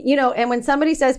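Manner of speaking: ordinary delivery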